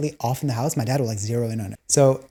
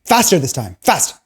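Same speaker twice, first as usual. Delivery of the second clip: impatient order; 5 semitones higher, 6 dB louder than usual. Retold or imitated. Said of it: "he's like".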